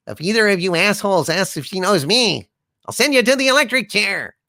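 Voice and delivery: ordinary talk, nothing marked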